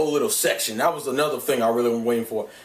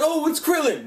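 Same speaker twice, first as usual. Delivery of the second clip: high, sing-song brush-off; mock dismissive fan; 12 semitones higher than usual. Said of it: "It's like".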